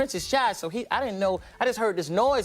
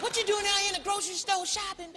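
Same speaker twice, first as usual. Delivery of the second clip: fan voice; 12 semitones higher, 4 dB quieter than usual. Retold or imitated. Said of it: "They be like".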